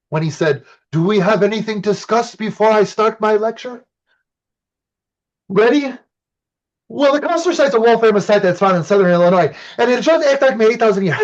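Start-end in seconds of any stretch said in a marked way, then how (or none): 0.9-5.9 put-on higher, French-accented professor inviting the class. Professor asks the class before his lecture, in a French accent. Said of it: "hilarious French accent". 6.9-11.2 rapid eager recitation. Retold or imitated. Student answers professor after reading.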